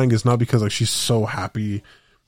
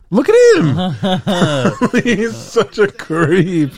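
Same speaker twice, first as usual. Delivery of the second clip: laughing disbelief; 9 semitones higher, 6 dB louder, much slower than usual. Real, not acted. Laughing at the creep video.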